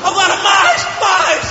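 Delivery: high shouted cheerleading; shouting. Shown as a demonstration. Comedian yelling to push the crowd into catharsis. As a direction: pump them up, yelling. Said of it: "Agora gritando".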